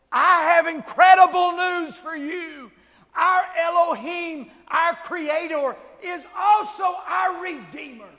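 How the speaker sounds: high, loud, ringing proclamation; full-force preaching. Real, not acted. Preacher announcing good news of a Redeemer to the congregation, full-force preaching. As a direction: proclaim it slowly and forcefully, building on key words.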